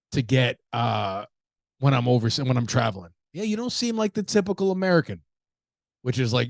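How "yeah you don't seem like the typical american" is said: high-pitched voice for someone paying a compliment; imitated admiring foreigner. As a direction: imitate an impressed local, pitched well up.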